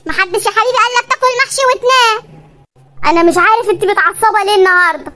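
normal talking voice

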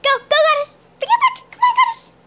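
high, loud coaxing call to the cat; sing-song call to bring the cat over; squeaky "come on" to a pet. Real, not acted. Calling cat Goddess to fetch.

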